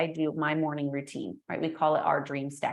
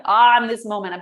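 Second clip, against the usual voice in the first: mock exasperation; 3 semitones higher, 12 dB louder than usual. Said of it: "I'm like".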